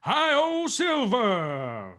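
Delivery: loud, soaring call urging the horse on; rallying call. Acted. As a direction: spur the stallion with a ringing cry.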